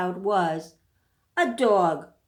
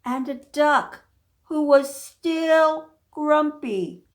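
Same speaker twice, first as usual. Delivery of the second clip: ordinary delivery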